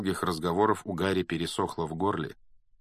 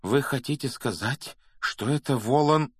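higher, building toward the dreaded name; dawning alarm; 6 semitones higher than usual. Acted. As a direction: repeat it with rising urgency, slowing on the last words. Repeats question, building to the name.

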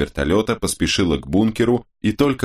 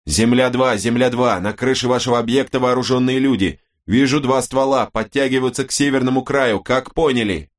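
raised, urgent radio call; urgent radio warning; 5 semitones higher than usual. A narrator's reading. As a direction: warn the team over the radio. Corvo relaying warning by radio. (срочное предупреждение по рации)

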